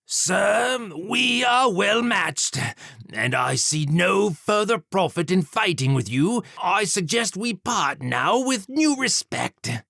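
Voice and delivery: high, pleading demon, shouting he is matched. A narrator's reading. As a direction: raise the voice high and beg to part as equals. Demon pleads, voiced character.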